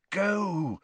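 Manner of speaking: high, drawn-out pleading; silent plea. A narrator's reading. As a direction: urge someone desperately in a high voice. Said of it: "Harry pleaded with him silently".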